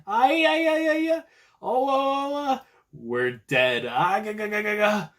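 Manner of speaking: high-pitched panicky babbling; panicked freakout. Retold or imitated. Viewer imitating Queen panicking, high panicked babble. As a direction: babble like a terrified goon. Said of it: "he's freaking out".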